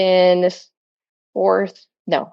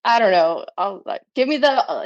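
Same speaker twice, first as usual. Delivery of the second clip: usual speaking voice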